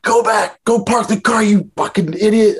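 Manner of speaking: hammed-up buddy scolding the drunk driver; mock scolding friend. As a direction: play the friend yelling at the reckless driver. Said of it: "And they're like".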